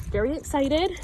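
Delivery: ordinary talk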